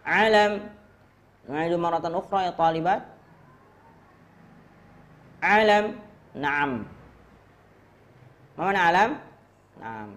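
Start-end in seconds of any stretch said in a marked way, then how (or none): 0.1-0.7 high, loud model word; drill call. Shown as a demonstration. Teacher modelling a word for students, loud drill call. 5.4-6.0 high, loud model word; drill call. Shown as a demonstration. Teacher modelling a word for students, loud drill call.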